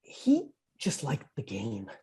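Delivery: low, soft and slow, almost to himself; hushed understanding of Kobe; quiet realization. Retold or imitated. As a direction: let it sink in gently. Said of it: "I'm like".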